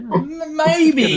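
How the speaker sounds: high, loud, stuttered into laughter; laughing incredulity. Real, not acted. Host mocking the eBay seller's dusty photo, laughing incredulously. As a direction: squeal it out through a laugh.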